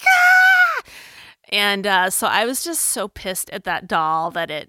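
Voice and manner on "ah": put-on high, loud toddler shriek; mock toddler scream. Mom imitating her daughter bolting up screaming. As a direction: scream like a startled little girl. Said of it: "was just like".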